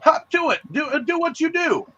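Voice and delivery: high mock-exasperated voice, yelling; exasperated Goblin King. Goblin King ordering goblins to hop to it, shrill. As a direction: shoo the lackeys off, hammed-up and shrill. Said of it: "he's like".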